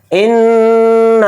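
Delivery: इन्ना with a held nasal ghunna on the doubled n; tajweed demo. Shown as a demonstration. Teacher showing students how to read इन्ना, nasal ghunna. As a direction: hum the नून of इन्ना through the nose, then stretch the ना. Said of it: "अब इन्ना के अंदर हम तजवीद को समझेंगे".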